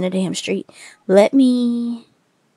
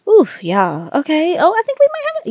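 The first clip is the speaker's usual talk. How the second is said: excited; 9 semitones higher, 3 dB louder than usual. Real, not acted. Seeing money covers the house.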